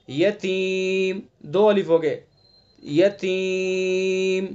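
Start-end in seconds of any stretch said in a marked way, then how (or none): 0.1-1.2 یتیم with the vowel held for two counts; two-alif elongation. Shown as a demonstration. 2.8-4.5 یتیم with the final vowel drawn out for three counts; three-alif elongation. Shown as a demonstration. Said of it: "تین الف ہو گئے".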